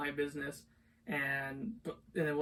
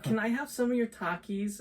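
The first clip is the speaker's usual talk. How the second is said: lightly higher-pitched imitation of a girl; imitated girl asking; 7 semitones higher, 3 dB louder than usual. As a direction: mimic her request, pitched up. Retold girl asking for snacks.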